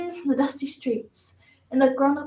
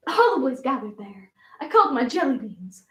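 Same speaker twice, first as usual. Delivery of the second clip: usual speaking voice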